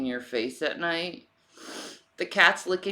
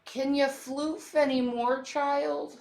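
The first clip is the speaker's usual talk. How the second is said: higher sing-song teasing question to the cat; cooing pet voice; 9 semitones higher than usual. Acted. Owner teasing her fluffy cat in a high cooing voice. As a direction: coo playfully at the fluffy cat.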